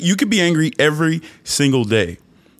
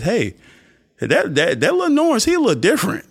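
lightly voiced onlooker, rising high; mock curious bystander pointing someone out; pitched-up imitation of a puzzled admirer; intrigued onlooker; 10 semitones higher than usual. Voices onlookers noticing him.

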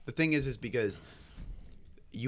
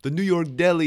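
imitated New York Italian accent, raised and loud; 8 semitones higher, 8 dB louder than usual. Improv partner naming his deli, imitated Italian accent. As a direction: push up the pitch for a mock Italian accent. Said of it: "he pulls out a Italian accent".

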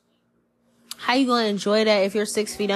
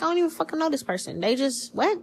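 high, fast and softer, bewildered at a stranger; a quick, high, hushed double take; mock baffled; 7 semitones higher, 4 dB quieter than usual. You meeting strangers later in life, in mock confusion. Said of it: "You're like".

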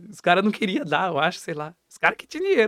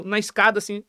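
usual speaking voice

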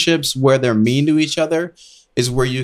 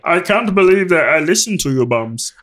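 higher, put-on voice of a disgruntled friend; grumbling angry friend; 5 semitones higher than usual. Comic imitation of the friend who sold his Bitcoin early, put-on griping. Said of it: "That's how he sounds?".

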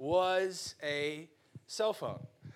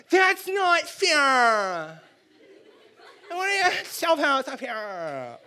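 high, drawn-out whiny bawling, fading; spoiled kid; 9 semitones higher, 7 dB louder, much slower than usual. Acted. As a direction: blubber it like a spoiled child. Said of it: "every spoiled American kid has said this".

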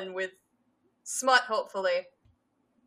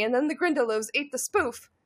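normal talking voice

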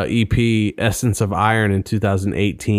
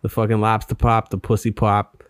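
put-on Boston accent; playful Boston accent. Shown as a demonstration. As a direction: talk like a Boston local, r's dropped. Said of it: "Yeah, you do it pretty good".

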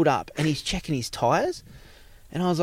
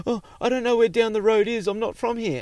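higher-pitched imitation of a flustered man; mock flustered, pitch jumping up in alarm; flustered, scared; 8 semitones higher than usual. Stranded driver answering a hitchhiker, imitated as flustered. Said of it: "all flustered. He was all scared".

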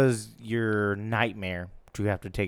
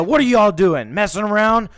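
raised, higher scolding teacher voice; 9 semitones higher, 8 dB louder than usual. Retold or imitated. Teacher scolding three boys in the bathroom, loud and high. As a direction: demand it like an annoyed teacher.

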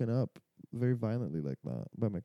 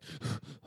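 sharp gasp; 8 dB quieter than usual. Retold or imitated. Retold waking up shocked.

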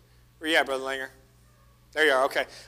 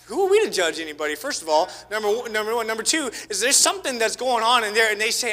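ordinary delivery

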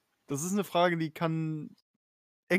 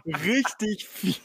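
tipsy and very slow; much slower than usual. Real, not acted. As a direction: drag it out, trying not to slur. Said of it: "Wie viel Mühe musst du dir grad geben".

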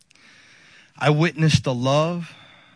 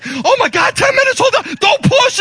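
shrieked, high-pitched mock panic; panic mode; 6 dB louder than usual. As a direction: imitate a nurse losing it. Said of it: "Miss Cocky goes into panic mode".

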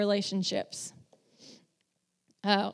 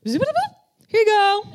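very high, playful sing-song with a laugh; cheerful kid; 11 semitones higher, 5 dB louder, faster than usual. Retold or imitated. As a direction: chirp it like a proud kid done fast. Connor fixes the brain.

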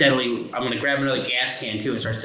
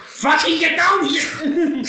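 high, slow, furious yell; angry yell; 3 dB louder, slower than usual. Acted. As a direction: bellow it down the hatch. Yelling at the creature.

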